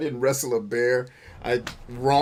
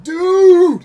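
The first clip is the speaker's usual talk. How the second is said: much higher, much louder delighted yell; excited exclamation; 16 dB louder than usual. Retold or imitated. Pharmacist friend seeing his Klingon shirt, mock excited yell. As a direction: squeal it out as the friend spotting the shirt. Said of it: "She's like".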